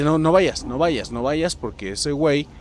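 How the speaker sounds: normal talking voice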